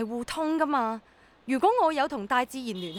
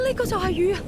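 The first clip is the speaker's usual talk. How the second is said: 高聲驚喜咁叫; 見到落雨，開心到跳起; 6 semitones higher than usual. Acted. Rain finally falls, joy. (終於落雨，興奮大叫)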